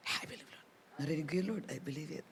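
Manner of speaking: breathy, unvoiced prayer; whispered prayer. Believer whispering thanks to the Lord.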